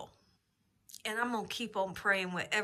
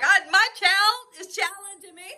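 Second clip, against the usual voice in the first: much higher, louder incredulous husband voice; husband laughing off the child's race dare; mock husband; 10 semitones higher, 7 dB louder than usual. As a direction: scoff at the boy's challenge. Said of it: "my husband's doing".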